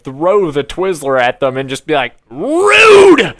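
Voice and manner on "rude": high, loud mock outburst; shouted rude. Imagined yell at talking teens, loud and high. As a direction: yell it like a scandalized moviegoer.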